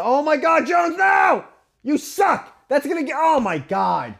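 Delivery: high-pitched sports fan outburst; real exasperated shouting. Real, not acted. Watching his team blow the game, exasperated shouting. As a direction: yell in dismay at the screen. Reacting to the lost play.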